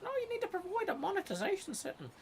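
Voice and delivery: mock the settings page scolding you; squeaky fast mock of the upload page; mocking the site. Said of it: "It's like".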